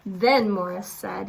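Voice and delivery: usual speaking voice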